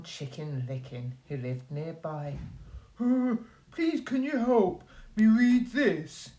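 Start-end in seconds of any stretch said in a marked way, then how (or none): 3.0-6.3 higher, hesitant polite wolf; wolf asking neighbour to read; timid wolf asking. Acted. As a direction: ask shyly for help. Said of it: "he asked".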